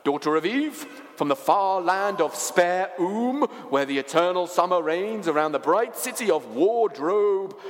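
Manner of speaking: grand, lilting faun proclamation; courtly creature making a lofty address; ceremonious faun. Acted.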